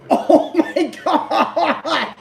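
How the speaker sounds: high wheezing laughter through words; laughing so hard it hurts; crying laughing. Real, not acted. Watching funny video, crying laughing.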